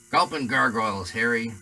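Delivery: loud, flustered outburst; Hagrid exclamation. A narrator's reading. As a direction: blurt it out, rattled.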